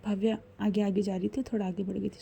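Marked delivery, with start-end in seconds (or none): none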